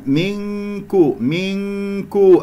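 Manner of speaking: منكو slowly, with a long light hum on the ن before ك; held nasal ghunnah. Shown as a demonstration. Teacher preparing the kaf sound, slow drawn-out nasal hum in منكو. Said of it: "أذهب إلى مخرج الكاف، أتهيأ لنطق حرف الكاف بغنة".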